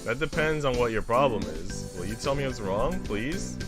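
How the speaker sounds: ordinary talk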